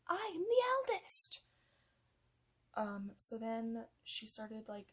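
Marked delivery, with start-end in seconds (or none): 0.1-1.4 high, loud, slow breathy whine; indignant Ursula. Retold or imitated. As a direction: insist you're the rightful heir. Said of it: "she was like".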